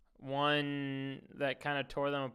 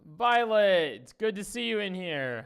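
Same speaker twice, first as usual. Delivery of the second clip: loud greeting; 6 semitones higher, 7 dB louder than usual. Real, not acted. Calling out to a newcomer.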